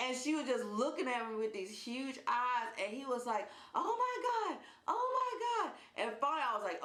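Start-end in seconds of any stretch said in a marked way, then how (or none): 3.7-5.7 very high mock-panicked squeal; imitated panic. John seeing the cat bite, high panicked imitation. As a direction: squeal it like a man losing his nerve.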